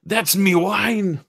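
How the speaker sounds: put-on slow, high-pitched try at a Scottish accent, half-hearted; a hammed-up Scottish drunk defending his wine, slowly; rough attempt at a Scottish accent, drawn out and high. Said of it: "I can't do it right now".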